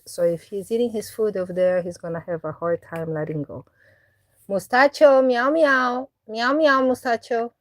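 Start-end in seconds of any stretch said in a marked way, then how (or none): 4.5-7.5 high, louder sing-song cat call. Real, not acted. Owner calling her cat away from his food, high coaxing meows. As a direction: coax him over with meows.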